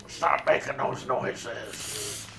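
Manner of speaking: slow, soft scolding boss; mock stern boss. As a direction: drag it out like a weary boss. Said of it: "fake boss scolding".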